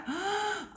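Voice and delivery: sharp high gasp in; demo gasp for air. Shown as a demonstration. Swim coach shows the breath, sharp gasp. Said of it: "take that breath".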